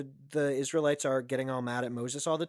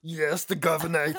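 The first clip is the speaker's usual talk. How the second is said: Austrian-sounding fake German accent; would-be German accent. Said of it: "He's Austrian".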